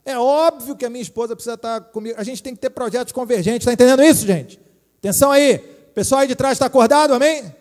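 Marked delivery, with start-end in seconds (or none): 3.7-7.5 loud call for attention; calling out to the room. Real, not acted. Pastor calling out to sleepy people at the back, loud. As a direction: wake up the back rows.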